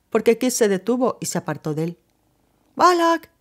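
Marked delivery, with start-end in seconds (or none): 2.8-3.3 exclaimed. A narrator's reading. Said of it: "exclamó una voz a su lado".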